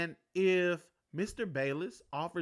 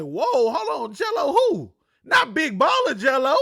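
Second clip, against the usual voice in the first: loud, excited double take, higher at the end; excited disbelief; 7 semitones higher, 10 dB louder than usual. Retold or imitated. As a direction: shout it like you can't believe it.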